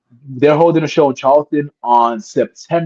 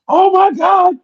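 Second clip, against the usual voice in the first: crowd cheering. Retold or imitated. The crowd cheers the wrestler after the chair shot, high excited yelling. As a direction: play the whole roaring crowd, pitched way up. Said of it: "Everybody's like".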